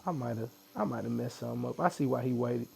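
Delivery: usual speaking voice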